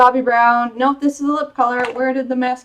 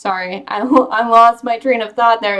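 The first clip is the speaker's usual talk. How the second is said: ordinary delivery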